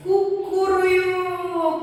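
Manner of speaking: high, slow, drawn-out cock-a-doodle-doo; rooster crow. Shown as a demonstration. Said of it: "The sound is like this".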